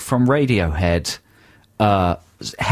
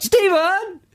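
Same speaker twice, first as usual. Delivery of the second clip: very high-pitched comic call; mock shout for help. Someone in peril shouts for the hero, high mock shout. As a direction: cry the name out high, as if in peril. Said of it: "They shout".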